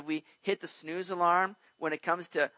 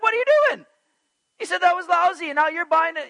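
much higher, louder mock outrage; indignant disbelief; 13 semitones higher, 10 dB louder than usual. Shopper confronting a friend wearing the garment, mock outrage. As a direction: call out the two-faced friend. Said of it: "you're like".

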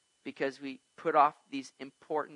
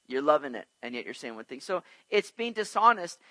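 usual speaking voice

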